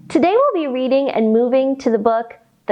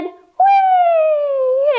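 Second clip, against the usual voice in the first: gleeful high whee; mouse whee. Acted. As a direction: squeal with delight like a mouse. Mouse cries in rhyme.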